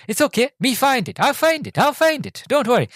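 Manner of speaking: very high, frantic little foreigner voice; squeaky mimicry of a panicked innocent; Enrico. Passenger promising to find the lost heart, high put-on voice. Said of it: "you've got Rowan Atkinson going".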